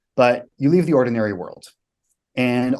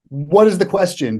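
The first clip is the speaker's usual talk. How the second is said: panicked question; 7 semitones higher, 5 dB louder than usual. Retold or imitated. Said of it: "And I'm like".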